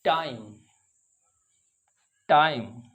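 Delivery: टाइम with a gliding ai; ai sound. Shown as a demonstration. Teacher showing the ai vowel to students. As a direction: glide from a to i in टाइम. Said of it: "आई की आवाज़ है".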